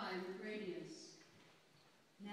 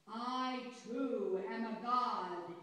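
slow, louder, otherworldly proclamation; loud, strange voice; 8 dB louder, much slower than usual. A narrator's reading. Amos defying the shepherds, loud strange voice. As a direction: declare it slowly like a prophet. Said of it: "he said in a loud, strange voice".

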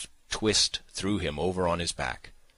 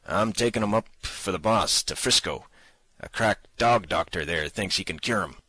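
louder, rough-voiced man lying casually; rough kidnapper voice; 4 semitones higher, 6 dB louder than usual. A narrator's reading. Kidnapper lies to the baggage man, loud rough voice. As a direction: bluff it off as a tough guy.

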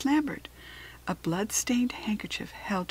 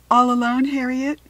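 louder and higher friendly hello; cheery greeting; 6 semitones higher, 11 dB louder than usual. A narrator's reading. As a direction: call it out brightly at the door. Visitor greeting Harriet at door.

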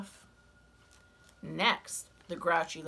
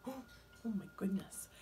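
gasping whisper; 9 dB quieter than usual. Acted. Storyteller reacts to hyena.

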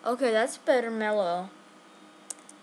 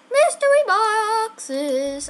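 1 excited announcement; 9 semitones higher, 6 dB louder, much slower than usual. Real, not acted. Announcing the reveal.

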